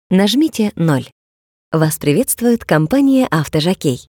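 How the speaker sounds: normal talking voice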